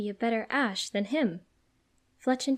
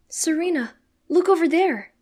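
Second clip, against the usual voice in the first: loud, raised urgent call; crying out; 8 semitones higher, 10 dB louder than usual. A narrator's reading. Ash pointing out trapped Pokemon, crying out. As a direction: shout to get her attention. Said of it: "he cries out".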